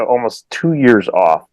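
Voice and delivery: ordinary talk, nothing marked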